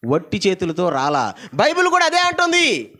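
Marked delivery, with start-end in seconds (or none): 1.5-2.9 high, loud proclaiming; preaching at full force. Acted. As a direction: announce it to the church. (గట్టిగా బోధించడం)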